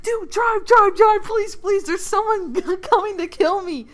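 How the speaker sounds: very high, urgent mock panic; hammed-up frantic pleading. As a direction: beg for your life, overacted with a laugh slipping in. Fleeing character begs friend to drive.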